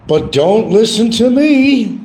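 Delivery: very high, loud, drawn-out singsong; sing-song catchphrase. Pretend. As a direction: belt it high and hold the last word.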